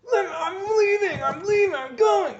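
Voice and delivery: high, loud, sobbing shriek as she breaks down; frantic crying scream. Retold or imitated. Girlfriend screaming to leave, high and crying. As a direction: cry it out in a high panicked scream, slowly.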